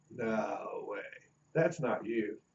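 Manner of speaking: soft, low mock disbelief; feigned disbelief. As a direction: drop your voice, playing amazed. Acted disbelief to the student.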